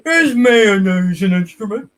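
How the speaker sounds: slow dopey question; a hint of Patrick Star. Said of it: "No, Patrick".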